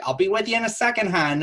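high, quick customer-service call; bakery worker. Acted. Bakery worker to waiting customer, high brisk call. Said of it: "people at the bakery have been like".

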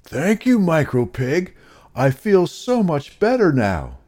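high, loud, smiling pig voice; happy Blue Pig. A narrator's reading. Blue Pig thanks Micro Pig for the cure, happy high voice.

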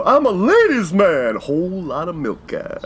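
slow, put-on ladies' man voice; quoting a favorite sketch character, slowly; Kevin McDonald catchphrase. Fan quoting a sketch catchphrase in character voice. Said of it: "So many catchphrases from him".

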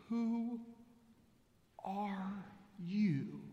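Who, are, you spaced out with long pauses; word by word. Shown as a demonstration. Preacher pressing the congregation with the question, slow and deliberate. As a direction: pause after each word. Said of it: "Did you hear me?".

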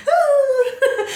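high excited squeal with a laugh; mock starstruck "ah", pitched high; re-enacted tipsy fangirl cry; tipsy fan squeal. Re-enacting tipsy fan meeting the actor.

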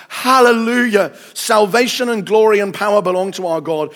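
high, loud proclamation of praise; ringing declaimed hallelujah; crying out. A narrator's reading. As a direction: shout praise as if for a great multitude. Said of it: "the loud voice of a great multitude in heaven crying out".

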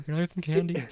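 lightly voiced begging kid; kid asking for candy. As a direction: ask sweetly like a trick-or-treater. Acting trick-or-treater asking.

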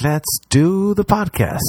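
high, slow, chirpy self-quote; mock eager. Host quoting his own eager plan. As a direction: bounce the idea brightly. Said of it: "And then I was like".

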